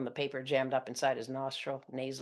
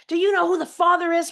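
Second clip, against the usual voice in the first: high, loud shriek; mom's shriek; 14 dB louder than usual. Retold or imitated. Mother shrieking at her daughter over the phone. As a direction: shriek the question hysterically. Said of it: "my mom shrieks".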